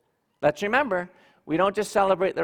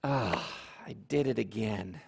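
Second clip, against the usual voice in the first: low, much softer sigh of failure; dejected admission; 7 semitones lower, 8 dB quieter than usual. Retold or imitated. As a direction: admit you failed again, deflated. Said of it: "or a year and twelve days later".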